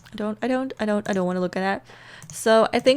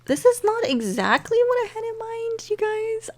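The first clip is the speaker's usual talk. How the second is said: dismayed; 13 semitones higher than usual. Real, not acted. Upset at the aged-up baby.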